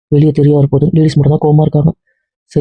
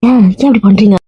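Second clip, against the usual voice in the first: pleading; 6 semitones higher than usual. Acted. Pleading with family.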